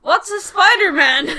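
high, loud mock-confused question; clueless Topher Grace. Co-host plays actor feigning ignorance to interviewer, loud mock confusion. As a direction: play dumb loudly, breaking into laughter.

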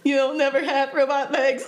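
imitating a robot voice while laughing. Quoting a movie character's robot voice to a friend, laughing. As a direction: giggle through a mock robot line. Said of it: "There's a specific line where he goes".

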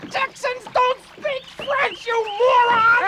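angry yell. Acted. Angry partner yells at the fisherman, in a furious shout. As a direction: yell the insult at him. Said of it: "don't yell at me".

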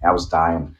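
ordinary talk, nothing marked

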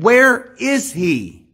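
drawn-out, weighty where is he; slow king's question. Retold or imitated. Preacher repeating the king's question to Ziba, slowly. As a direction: let each word hang, demanding.

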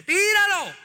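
high, loud divine command; commanding shout. Acted. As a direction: bark the order from on high. God commands throwing the money. (Dios ordena a gritos: ¡tíralo!)

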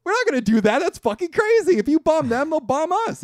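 high, loud mock outburst of outrage; angry refusal. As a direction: shout it down as an imitated furious refusal. Fred angrily refusing the bombing.

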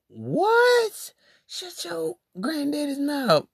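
sudden high-pitched what, then snap back; outraged disbelief. Retold or imitated. Retelling his reaction to her post, high mock outrage. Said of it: "And I'm like".

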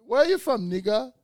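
high, loud suspicious African cabbie voice; loud, high mock of a distrustful driver. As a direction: mimic a wary cab driver squinting at you. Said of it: "They didn't trust me".

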